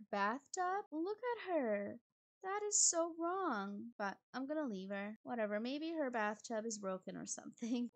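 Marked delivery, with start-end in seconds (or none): 0.9-3.9 higher, drawn-out disapproval; mock outrage. Real, not acted. Player disapproving of the guest upstairs, mock outrage.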